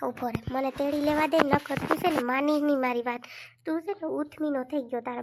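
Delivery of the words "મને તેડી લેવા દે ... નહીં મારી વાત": ordinary talk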